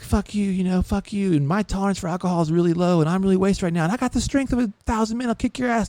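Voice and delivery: high-pitched drunk bragging, lightly acted; lightly voiced drunk. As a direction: play a wasted loudmouth picking a fight, pitch up. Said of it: "he's really drunk at this point".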